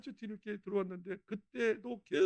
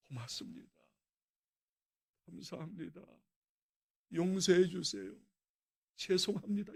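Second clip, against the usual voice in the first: gentle heaven language; 2 semitones lower than usual. Shown as a demonstration. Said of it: "천국방언을 만든 거예요".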